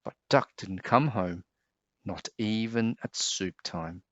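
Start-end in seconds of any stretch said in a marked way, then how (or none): none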